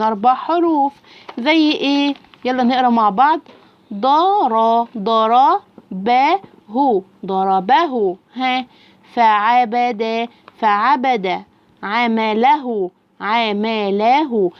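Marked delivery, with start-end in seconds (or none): 3.9-14.5 slow, drawn-out reading drill; one syllable at a time. Shown as a demonstration. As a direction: stretch and join the syllables for a learner. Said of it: "يبدأ واحدة واحدة يقرأ لي الكلمة".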